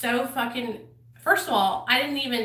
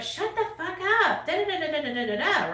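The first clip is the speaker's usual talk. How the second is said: higher, sneering mimic of a dismissive friend; mocking imitation of Tara; 7 semitones higher than usual. Friend mocked for shutting down [name]'s fears, sneering mimic. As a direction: parrot her brush-off with a mocking sing-song. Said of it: "this is how she did me".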